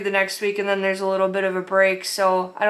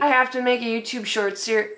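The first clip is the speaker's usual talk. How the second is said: ordinary delivery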